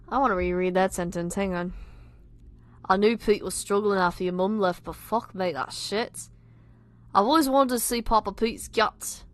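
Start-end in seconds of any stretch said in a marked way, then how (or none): 2.9-9.2 put-on Scouse; bad Liverpudlian accent. Reader redoing Tom's line as British, bad Liverpudlian accent. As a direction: read it in a shaky attempt at Liverpool accent. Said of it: "my shit Liverpudlian accent".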